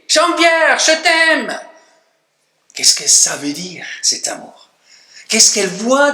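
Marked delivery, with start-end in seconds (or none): 0.1-1.6 imitating a small girl's high, sustained cry; cri de fillette imité. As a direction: cry it out high, like a child calling across the house. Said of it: "Et elle crie". (petite fille qui crie, voix aiguë imitée)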